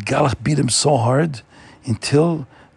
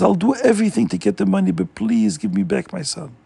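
higher, quick imitated begging; pleading; 7 semitones higher than usual. Father begging the landlord to return his son, mild pleading. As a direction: beg for the son back, lightly voiced. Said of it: "started begging the Poritz to please".

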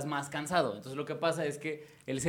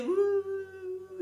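mock falling yelp; 14 semitones higher than usual. Falling forever in a dream.

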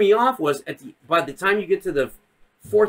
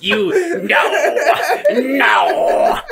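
high, hammed-up cry through laughter; mock death wail; 9 semitones higher, 4 dB louder, much slower than usual. Mace Windu struck down in retelling, mock drawn-out wail.

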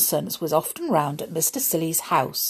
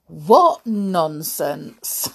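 drawn-out amusement; 4 dB louder, much slower than usual. Acted. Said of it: "storyteller's amused closing comment".

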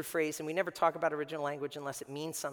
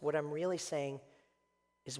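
usual speaking voice

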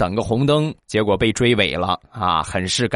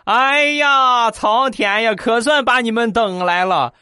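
overjoyed aunt crying out, stretched and high; excited call; 13 semitones higher, slower than usual. Acted. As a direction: auntie stuck in the toilet calling to her rescuers, excited and high. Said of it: "很兴奋的大声喊道".

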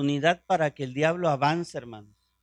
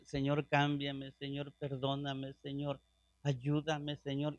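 quiet pleading prayer; 5 semitones lower, 12 dB quieter than usual. Retold or imitated. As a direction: beg the Lord softly, a touch imitated. Imita oración de súplica repetida. (súplica suave y lenta)

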